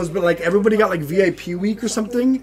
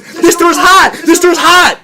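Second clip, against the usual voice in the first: high shouted warning; mock alarm yell; retold frantic yell; 9 semitones higher, 8 dB louder, slower than usual. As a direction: shout it like a panicking mom. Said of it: "she goes".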